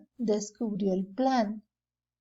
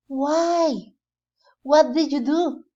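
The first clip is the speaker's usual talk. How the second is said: surprised question; 4 semitones higher, 6 dB louder, slower than usual. Acted. Alibaba asks Morgiana.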